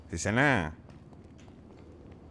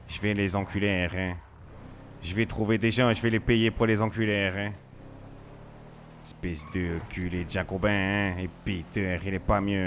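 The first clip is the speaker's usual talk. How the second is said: lightly voiced gangster character, every line capped with hein; a hint of a grumbling hoodlum, ranting about snitches; slight in-character tough-guy voice; RP character Dove; 3 dB quieter than usual. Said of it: "je commence à être dans mon perso".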